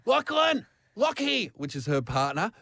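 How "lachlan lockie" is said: higher, called name; calling out. Retold or imitated. Housemate calling her partner for help, imitated higher. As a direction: call him from upstairs. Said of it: "I hear Lauren's voice go".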